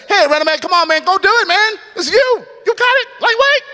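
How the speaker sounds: excited friend cheering in a sky-high voice; voice shooting high. Retold or imitated. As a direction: pump him up in a high, shooting voice. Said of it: "his voice will shoot high".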